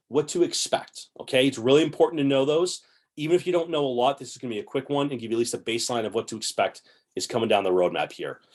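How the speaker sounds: normal talking voice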